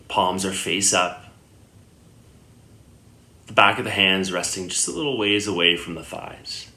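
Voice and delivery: unhurried meditation guidance with long pauses; guided meditation. Acted. Yoga teacher guiding listeners lying down into Yoga Nidra, calm meditation voice. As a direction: settle the listener gently, leaving long silences between phrases.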